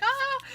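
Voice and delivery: high, loud reenacted squeal; very high-pitched excited "ah"; delighted squeal. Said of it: "I was literally squealing when I opened it".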